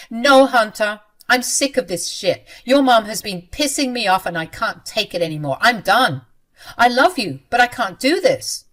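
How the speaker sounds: loud, done with it; fed-up outburst. Acted. Wife tells husband she can't take his mother anymore: angry outburst. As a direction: vent everything, then break off.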